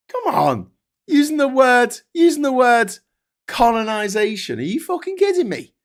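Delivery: high, loud, incredulous outrage; outraged. Real, not acted. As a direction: blow up in disbelief. Outraged at the colonization remark.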